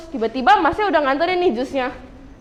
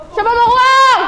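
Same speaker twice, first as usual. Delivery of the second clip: loud, high shout to the audience; calling out; 7 semitones higher, 7 dB louder, slower than usual. Real, not acted. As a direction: whip up the crowd with the question.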